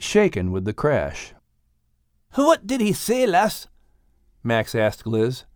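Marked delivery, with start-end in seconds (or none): 2.3-3.7 put-on higher Scottish-accented voice; a hint of a Scottish accent; Scottish Max. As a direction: play Max asking Liz.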